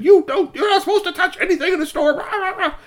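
high, loud scolding with laughter in it; mother telling her son off, half-laughing. Acted. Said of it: "and said".

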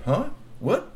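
high startled what; surprised. A narrator's reading. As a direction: snap up in surprise. Troll surprised.

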